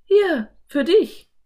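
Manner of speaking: put-on girl giving her brother a hat; eager Anne. A narrator's reading. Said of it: "reichte sie Philipp".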